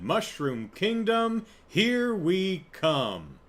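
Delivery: high, slow, drawn-out mock proclamation; imitating a stilted heroic announcement, very slowly; odd trailer delivery. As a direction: stretch each word in an odd high voice. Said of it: "there's an odd delivery".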